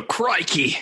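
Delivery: a laughing Australian "Crikey!"; mock Australian crikey. Host jokes about bursting out Australian, mock Australian crikey. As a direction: bust out an Aussie crikey. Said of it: "if I just bust out a".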